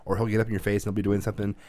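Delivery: ordinary talk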